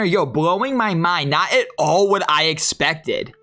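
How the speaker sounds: ordinary talk, nothing marked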